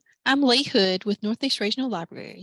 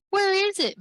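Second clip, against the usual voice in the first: much higher, pleading question; begging; 10 semitones higher than usual. Acted. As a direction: plead with her for the answer. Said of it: "begged Stella".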